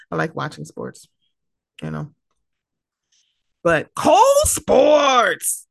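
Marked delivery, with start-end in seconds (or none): 3.9-5.7 loud, high, drawn-out holler; hype shout-out. Real, not acted. Shouting out a sporty friend on the podcast, long loud holler. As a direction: stretch the name out like a crowd cheer.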